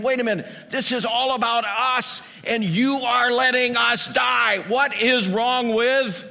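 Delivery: high, loud, indignant shouting; angry shout. Acted. Disciples blaming Jesus as the boat sinks, shouting angrily. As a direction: throw the blame at him at full volume.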